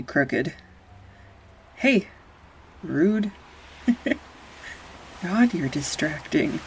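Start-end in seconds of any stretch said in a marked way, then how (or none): 1.8-3.3 high playful Hey!, mock offended; indignant yelp. To her art partner, a high mock-offended yelp. 3.9-5.5 high breathy laugh into the word; laugh, caught off guard. Real, not acted. Laughing at her classmate, flustered.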